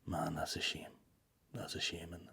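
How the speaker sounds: whispered fake sympathy; mock whisper. Comedian parodies a pitying punter, whispering. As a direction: breathe it out with sarcastic pity.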